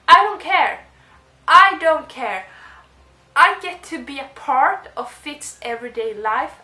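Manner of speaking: loud, high, defiant fan excitement; thrilled fan brushing off the critics, loud and high; defiant gushing. Retold or imitated. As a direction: shout your love for the book over anyone's objections. Gushing retold reaction to critics.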